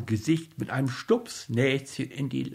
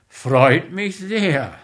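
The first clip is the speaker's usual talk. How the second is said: grumbled; 4 semitones higher, 5 dB louder, slower than usual. Acted. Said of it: "brummte Mitja".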